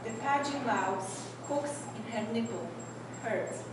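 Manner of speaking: normal talking voice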